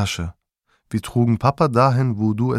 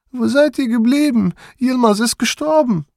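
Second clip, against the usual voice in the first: loud, much higher panicked cry; grandma crying out; 5 dB louder than usual. A narrator's reading. Grandma calls to the returning pair, shrill panicked cry. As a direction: wail it out in alarm. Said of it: "rief Oma".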